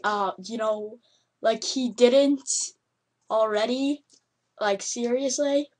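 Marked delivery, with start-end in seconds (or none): none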